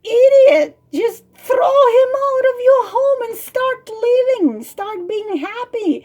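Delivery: high, loud storybook outburst; exasperated scolding. Acted. Bird tells girl to throw the frog out, exasperated. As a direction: scold her at the top of your voice.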